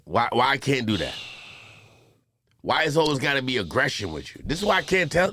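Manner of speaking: ordinary delivery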